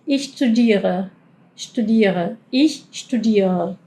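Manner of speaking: "studiere" slow, the r fading; German r; r almost silent. Shown as a demonstration. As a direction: let the r in "ich studiere" disappear. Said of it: "casi no suena la R".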